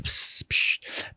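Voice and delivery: repeated hissed "psst"; shushing. Acted. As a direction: keep shushing like a fussy passenger. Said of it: "mach die ganze Zeit so".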